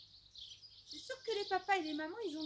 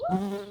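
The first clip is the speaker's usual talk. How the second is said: deep loud hoot; 9 semitones lower, 14 dB louder than usual. Pretend. Playful exclamation after frog.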